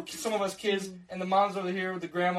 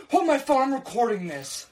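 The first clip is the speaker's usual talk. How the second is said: mock urgent yell; 7 semitones higher, 5 dB louder than usual. Mom yelling mid-argument.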